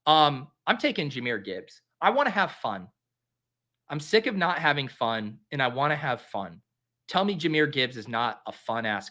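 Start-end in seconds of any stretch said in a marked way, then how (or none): none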